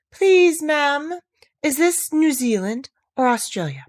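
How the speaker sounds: put-on high, bright polite little-girl ask. A narrator's reading. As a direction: ask sweetly and timidly, pitched high. Alice asks politely, acted.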